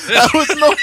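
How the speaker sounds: high, loud laughing echo. Real, not acted. Cohost echoing the movie cow's name, laughing loud and high. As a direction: crack up as you repeat it. Echoes Norman line, laughing.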